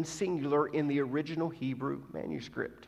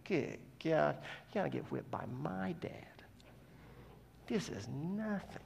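hushed, dismissive mock scoff; scornful aside; 8 dB quieter than usual. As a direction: scoff under your breath, half whispered. Imagined scoffing at crying classmates.

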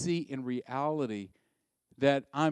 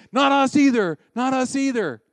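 much higher and louder echo; eager chorus; 11 semitones higher, 13 dB louder than usual. Retold or imitated. Disciples echoing Peter to Jesus, loud and eager. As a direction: chime in loudly, keen to agree. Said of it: "all the other disciples are going".

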